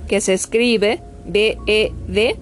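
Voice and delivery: ordinary delivery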